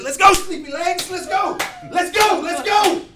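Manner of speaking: very high, loud mock-chiding; hurrying shout. Medic slapping a patient to hurry, teasing shout. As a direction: hustle him along teasingly. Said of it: "did this very unprofessionally".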